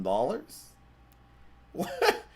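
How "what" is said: squeaky high "What?", then "Wait, what?"; can't believe what you just read; shocked disbelief. Real, not acted. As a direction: voice jumps way up in shock. Shocked by auction headline.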